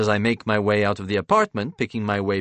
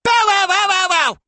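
loud, very high squeaky play-bark; barking child; 9 dB louder than usual. A narrator's reading. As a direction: bark like a little boy playing dog. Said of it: "barks Remington".

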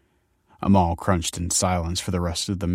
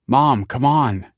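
higher, louder, drawn-out complaint; exasperated son calling out slowly; irritated; 5 semitones higher, 5 dB louder, slower than usual. A narrator's reading. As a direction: hurry your mum along impatiently.

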